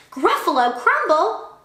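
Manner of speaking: high, startled echo; startled question. Acted. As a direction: repeat it in alarmed disbelief. Gruffalo alarmed by the mouse's threat.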